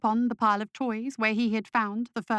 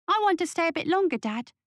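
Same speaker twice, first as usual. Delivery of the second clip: put-on high-pitched whiny child; whining boy; 7 semitones higher, faster than usual. A narrator's reading. Son begging Dad to stay longer, high whine. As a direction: plead quickly in a high little boy's whine. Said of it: "Christopher whined".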